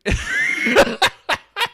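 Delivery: high, loud cackle cutting off the words; real burst of laughter. Real, not acted. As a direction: burst out laughing mid-thought. Cracking up at the clip.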